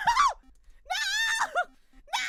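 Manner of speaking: high, loud drawn-out screams; shrieking, drawn-out refusals; anguished wailing. Real, not acted. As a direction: howl it in despair. Streamer wails over lost game.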